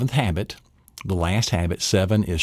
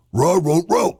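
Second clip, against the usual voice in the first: higher, louder mock bark; playful bark; dog barking; 8 semitones higher, 4 dB louder than usual. Dog barks again.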